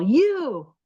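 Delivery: high, drawn-out mock holler; retold yell. Retelling a voice through the wall, mock yell. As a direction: imitate someone calling out, voice swooping up. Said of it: "yelled through the wall".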